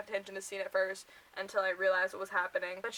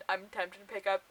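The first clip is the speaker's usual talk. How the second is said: ordinary talk, nothing marked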